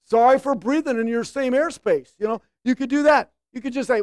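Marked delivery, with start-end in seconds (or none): 0.0-2.1 defensive sarcasm. Acted. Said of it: "get a defense of yourselves".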